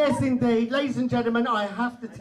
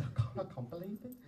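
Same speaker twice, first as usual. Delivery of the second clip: breathless disbelief; 13 dB quieter, faster than usual. Retold or imitated. Stunned at the gift.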